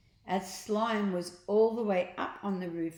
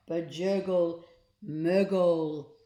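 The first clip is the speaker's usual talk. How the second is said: louder gloopy creature voice; slime gurgle words; 5 dB louder, slower than usual. A narrator's reading. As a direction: gargle the nonsense.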